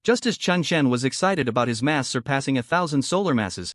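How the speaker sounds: ordinary delivery, nothing marked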